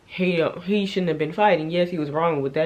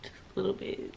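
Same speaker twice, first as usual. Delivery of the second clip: sheepish murmur; 3 semitones lower, 14 dB quieter than usual. Real, not acted. Sheepish admission.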